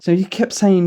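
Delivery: normal talking voice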